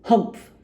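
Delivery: loud scornful humph; Uppity sniffs at the goblin, loud; rude sniff. Acted. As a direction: sniff it out with disdain. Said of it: "sniffed Mister Uppity rudely".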